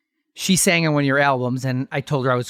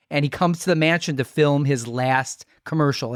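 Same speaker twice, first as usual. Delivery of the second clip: normal talking voice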